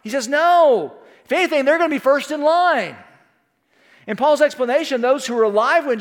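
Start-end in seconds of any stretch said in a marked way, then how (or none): 0.3-3.0 raised, louder, firm rebuttal; emphatic denial. Retold or imitated. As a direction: voice an apostle firmly setting people straight. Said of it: "Paul's also emphatic concerning this question".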